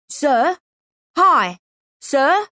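put-on high, urgent little street boy; boy calling out. A narrator's reading. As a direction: call up to get the man's attention. Boy tugging showman's coat, calling.

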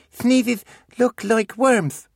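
put-on slow, high little-boy voice; toddler voice. Beckett goes off topic, slow and high. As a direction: draw it out like a small child.

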